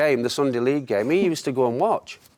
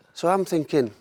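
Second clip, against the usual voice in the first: normal talking voice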